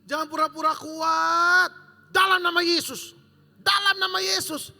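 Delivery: loud, slow warning to the men; preaching shout. Acted. Preacher warns the men to run, loud shout. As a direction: shout it from the pulpit, slow and forceful.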